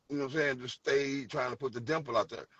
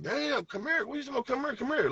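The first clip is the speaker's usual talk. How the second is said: higher, mocking New York girl; mocking New Yorkers; 11 semitones higher than usual. New York girls mimicking how Saint Louis guys talk, higher and mocking. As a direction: echo the Saint Louis "come here" back in a much higher mock voice. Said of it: "They like".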